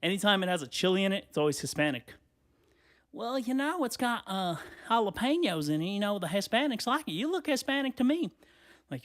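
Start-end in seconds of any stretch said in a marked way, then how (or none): 3.1-8.3 high saleswoman voice. Retold or imitated. Sample lady again.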